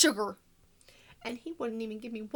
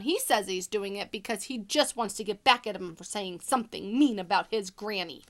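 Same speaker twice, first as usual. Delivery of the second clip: ordinary delivery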